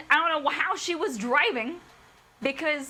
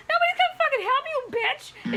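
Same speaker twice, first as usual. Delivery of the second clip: high, fast mocking jeer; mock heckling; 9 semitones higher than usual. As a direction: sneer at her as if she is beyond saving. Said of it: "And I'm like".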